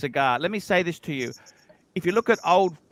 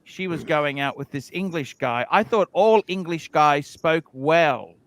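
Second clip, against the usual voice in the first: ordinary talk, nothing marked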